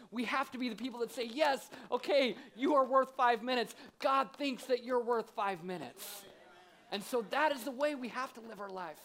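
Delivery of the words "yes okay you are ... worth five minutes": higher, louder and slow, insisting kindly; slow it down and lift it like a promise; emphatic reassurance. Acted. Pastor voices the helper who says yes, slow and ringing.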